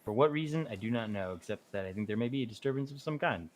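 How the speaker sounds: ordinary talk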